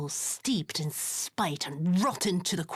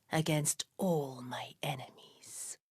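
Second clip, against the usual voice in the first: soft, breathless threat; menacing whisper; 3 semitones lower, 6 dB quieter than usual. Acted. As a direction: let the threat sink to a whisper. Villain's vengeful monologue.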